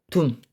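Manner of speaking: 둠 cut off short and low; staccato. Shown as a demonstration. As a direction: clip the final 둠. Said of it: "구음을 한번 해보겠습니다".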